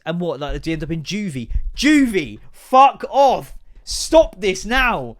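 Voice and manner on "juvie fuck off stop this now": loud, higher-pitched protest; exasperated outburst. Acted. Parent sick of American slang at home, loud exasperation. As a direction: burst out in mock outrage, dragging the last words.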